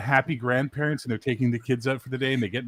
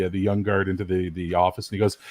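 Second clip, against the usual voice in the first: ordinary delivery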